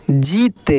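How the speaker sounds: जीत with a long, stretched ī, pitch falling low; drawn-out long ī. Shown as a demonstration. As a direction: stretch the ī in जीत as a teacher demonstrating. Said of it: "इसका उच्चारण खींच कर होता है". (जीत की ई खींच कर)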